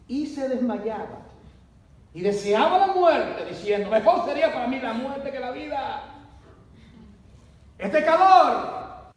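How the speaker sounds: Jonás desesperado, agotado por el calor, alto y fuerte; un profeta harto que pide morir; Jonás quejándose. Acted. (Jonás lamentándose, alto y fuerte)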